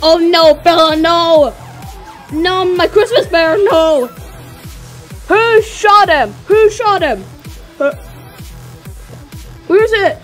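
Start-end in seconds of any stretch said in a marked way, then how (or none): 0.0-7.2 loud grieving cries; distressed shouting. Pretend. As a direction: wail over the fallen bear. Toy bear shot in battle.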